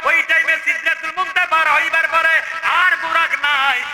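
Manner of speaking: high, loud chanted narration; full-force preaching. Acted. Preacher voicing the boy's answer to his father, high chanted preaching. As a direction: soar up on the address and sustain it loudly.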